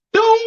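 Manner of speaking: high, ringing bell imitation; drawn-out high "doom"; imitated round bell. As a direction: sing out the bell that ends the round.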